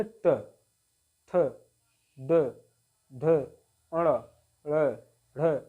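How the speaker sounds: ट, ड, ढ sounded singly with curled tongue touching the palate; retroflex consonant demonstration. Shown as a demonstration. Teacher showing learners how the tongue touches, demonstrative articulation. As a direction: isolate each letter, tongue bent upward, pausing after each. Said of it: "हमारा जीभ मुड़ करके touch होता है".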